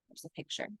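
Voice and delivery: soft unvoiced aside; hushed aside over the book; whispered aside. Real, not acted. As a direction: breathe it to the listener. Reader's aside whispered to child.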